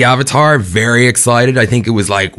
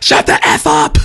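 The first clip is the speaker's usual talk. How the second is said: mock shout.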